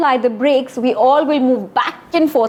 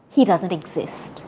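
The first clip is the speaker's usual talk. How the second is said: softer, dismissive aside; 5 semitones lower, 6 dB quieter than usual. Shown as a demonstration. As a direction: brush the wolf away, softly. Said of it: "act a little snooty".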